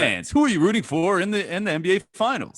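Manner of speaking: high, booming broadcaster read; Joe announcer voice. Shown as a demonstration. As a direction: sell it like a big radio announcer. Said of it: "My Joe announcer voice".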